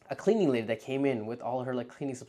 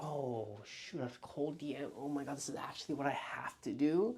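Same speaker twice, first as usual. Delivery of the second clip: hushed realization; 11 dB quieter than usual. Retold or imitated. Realizing cold DMs.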